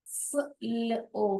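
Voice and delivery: फ ल ओ drawn out slowly, letter by letter; sounding out letters. Shown as a demonstration. Teacher spelling out sounds for students. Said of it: "फ्लो".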